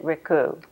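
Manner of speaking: Ricou said as rih-KOO; name pronunciation. Shown as a demonstration. Interviewer checking the guest's surname, Ricou pronounced rih-KOO. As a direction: say Ricou with a short first syllable and a long "koo". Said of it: "so you pronounce it".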